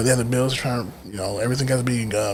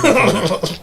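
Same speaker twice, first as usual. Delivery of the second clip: laughing; 8 semitones higher, 6 dB louder than usual. Real, not acted. Joking, breaks into laughter.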